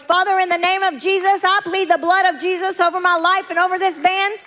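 high, forceful preacher's cry; retold screamed prayer. Praying for protection in the van, retold as a loud cry. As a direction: cry it out as a fervent plea. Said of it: "began to scream at the top of my voice".

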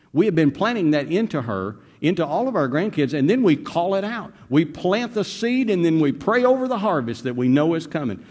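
fervent preaching. Acted. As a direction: preach it with growing fire. Preacher rising in fervor.

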